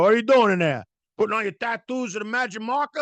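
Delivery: high, loud teasing call through a door; hammed-up nagging holler; mock dad yelling. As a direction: imitate a dad hollering at his kid.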